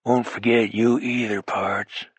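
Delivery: low, quiet cowboy farewell. A narrator's reading. Smith saying goodbye to Hawk in his low voice. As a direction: keep it low in the chest, unhurried. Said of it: "in his low voice".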